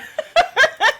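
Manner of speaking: high, loud giggling burst; laugh. Real, not acted. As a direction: laugh at your own melodrama. Laughs at her own impression.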